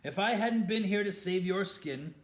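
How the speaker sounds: defiant shouting. A narrator's reading. Harry defending Lupin against Snape, loud and fast. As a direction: argue back hard and quick.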